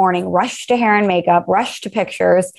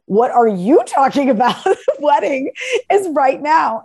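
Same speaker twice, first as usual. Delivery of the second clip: high, laughing disbelief at the friend; half-laughing, exasperated and pitched up; incredulous; 7 semitones higher, slower than usual. Retold or imitated. Retelling her reply to the late friend.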